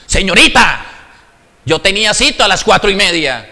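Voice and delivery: loud, fast, pushy complaint; rude demand. Acted. To the receptionist, an impatient patient's loud rude demand. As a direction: snap at the receptionist like you're owed.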